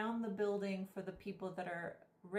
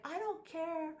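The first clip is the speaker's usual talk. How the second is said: mock yell, much higher and pushed, down the phone; imitating a phone yell; 9 semitones higher than usual. Guy at the club door yelling into his phone, imitated high mock yell. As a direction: bark orders at someone on the other end, pitched way up. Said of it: "he's yelling on his phone".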